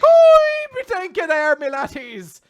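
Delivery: high, loud hammed-up Irish brogue. Pirate-captain podcaster greeting the crew in hammy Irish. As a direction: ham up a stage Irish captain. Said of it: "a stereotypical Irish guy".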